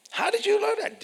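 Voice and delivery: high, impressed question; amazed questioner. Pretend. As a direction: pitch way up in wonder. Imagined listener asks.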